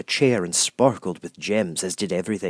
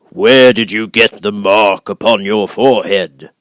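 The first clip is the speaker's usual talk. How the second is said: great and terrible Oz; 12 dB louder than usual. Acted. Oz questions Dorothy.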